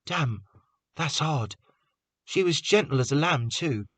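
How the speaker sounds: sympathetic. A narrator's reading. Prentice consoling Carter softly.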